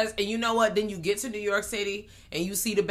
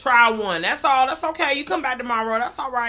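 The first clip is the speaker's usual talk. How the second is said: mock encouragement; 5 semitones higher, 6 dB louder than usual. Voices encouragement to the scared team.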